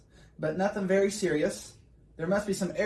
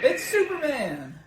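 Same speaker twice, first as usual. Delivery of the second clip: higher, slow proclaiming; awed announcement; 7 semitones higher, 3 dB louder, slower than usual. Acted. Onlooker proclaiming Superman, higher and slow. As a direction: draw out the name in awe. Famous crowd cry.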